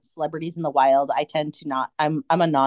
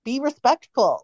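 higher mock-irritated scolding voice; annoyed scolding fan; 7 semitones higher than usual. Fan mocking a rude concertgoer with a higher, exasperated scold. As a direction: play the rude fan hissing at the one in front. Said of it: "not the".

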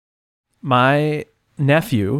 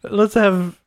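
mock posh; 6 semitones higher, slower than usual. Mocking Caleb's word.

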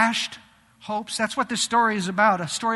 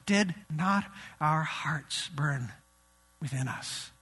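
hushed awe; 6 semitones lower, 6 dB quieter, slower than usual. Retold or imitated. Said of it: "they uttered these words".

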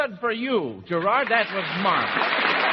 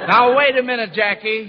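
loud, raised stop; calling out; 4 semitones higher, 8 dB louder than usual. Real, not acted. Quiz host stopping Benny's bogus answer, loud. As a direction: cut him off firmly.

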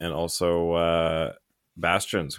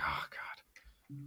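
whispered oh God under the breath; a mock-despairing whispered groan; exasperated whisper; 17 dB quieter than usual. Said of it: "It's like".

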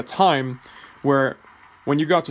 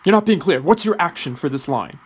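higher, louder mock coach demanding an answer; action-heavy coach; 4 semitones higher, 4 dB louder than usual. Action-heavy coach pressing an unclear actor for the action, mock insistent. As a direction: push the questions like an insistent acting coach. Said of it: "really action-heavy coaches".

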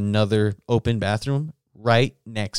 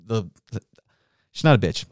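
ordinary talk, nothing marked

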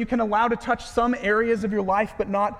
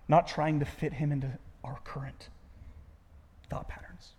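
whisper; 4 semitones lower, 7 dB quieter than usual. Real, not acted. Preacher drops to a whisper.

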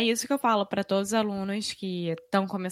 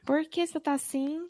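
high, sing-song mineiro voice; sweet caipira r, lilting high; Minas accent; 8 semitones higher than usual. Shown as a demonstration. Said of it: "Minha família de Minas toda fala assim".

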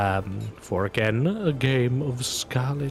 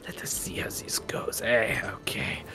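put-on Bretonnian accent. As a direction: murmur it like a confident card player. GM voices gambling NPC.

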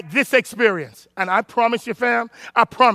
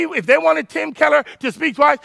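normal talking voice

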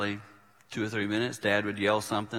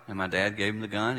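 ordinary talk, nothing marked

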